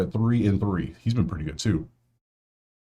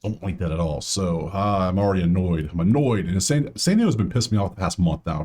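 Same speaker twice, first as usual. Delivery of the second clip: ordinary delivery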